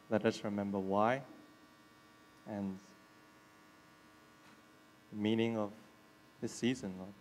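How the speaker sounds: soft, unhurried prayer voice. Real, not acted. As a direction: pray it quietly with pauses. Leads an opening prayer.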